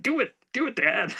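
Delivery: put-on high-pitched eager kid urging dad; daughter's high-voiced cheering; imitating daughter. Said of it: "my daughter, of course, is like".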